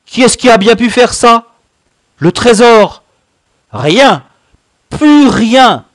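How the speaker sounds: high, loud outraged griping; Grand Gibus. A narrator's reading. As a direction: wail in indignant disbelief at the loss. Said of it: "râlait Grand Gibus". (râler, indigné, d'une voix forte et aiguë)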